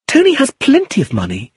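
high-pitched indignant protest; fly to his defence, pitched high; angry cry. A narrator's reading. Iris defending Tony to George, angry. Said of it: "she cried angrily".

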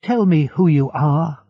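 urgent cry. A narrator's reading. Edmund to the unseen voice, urgent cry. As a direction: cry out to be answered. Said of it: "cried Edmund".

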